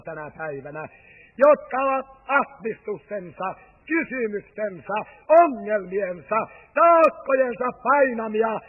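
loud, driving preacher's voice; fervent preaching. Acted. Preacher appeals to the congregation with loud, driving preaching. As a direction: preach it out to the hall with full force. Preacher rises to full force.

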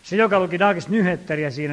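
ordinary talk

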